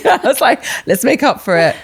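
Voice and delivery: laughing high through the words. Retold or imitated. Laughing as she tells her friend about February decorations. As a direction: giggle it out, pitch up high.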